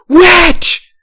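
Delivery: first wife shrieks as she attacks Farah; screaming. A narrator's reading.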